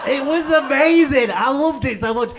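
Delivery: high, loud, thrilled outburst; delighted gushing. Real, not acted. Comedian thrilled at being chatted up, delighted gushing. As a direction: gush at the top of your voice.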